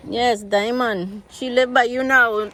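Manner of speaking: usual speaking voice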